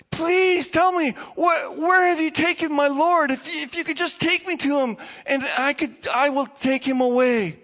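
high, loud pleading through tears; Mary begging the gardener, tearful; tearful plea. Acted. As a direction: beg him, close to crying.

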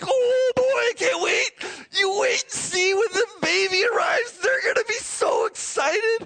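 high, hammed-up giddy excitement. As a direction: play someone who can hardly wait. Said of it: "He was all giddy".

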